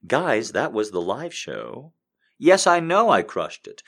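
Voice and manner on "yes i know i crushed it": excited. Real, not acted. Boasting after live show.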